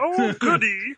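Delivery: high cartoon alien voice, laughing through it; Marvin the Martian voice. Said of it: "I've been working on my Marvin the Martian impression".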